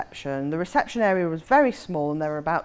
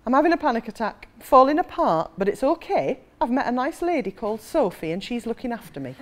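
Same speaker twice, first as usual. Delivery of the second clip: put-on higher-pitched Geordie girl; thick Geordie accent; 4 semitones higher than usual. Young woman ringing her family, thick Geordie accent. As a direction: do an anxious Geordie on the phone. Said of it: "in a thick Geordie accent".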